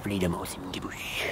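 hushed unvoiced whisper. Real, not acted. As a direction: whisper it secretly. Whispered aside.

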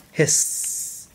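long sibilant "hisss"; snake hiss. Pretend. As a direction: draw out the s like a snake. Snake in picture book.

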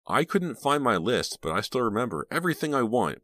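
put-on high, eager little-boy voice; excited. A narrator's reading. Little brother tells Reva about his list, excited. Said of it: "Michael said excitedly".

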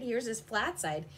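plain reading voice

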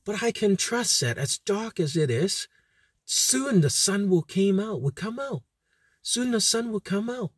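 ordinary talk

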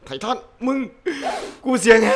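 high, strained hoarse cry with a laugh. Real, not acted. Gamer yelling at the titan as the voice goes, hoarse. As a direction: push it high until the voice cracks.

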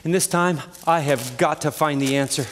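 ordinary delivery

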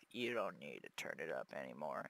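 soft, low voice for the card; talking card voice. Pretend. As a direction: speak as the graphics card, hushed. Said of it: "Sometimes the card's just like".

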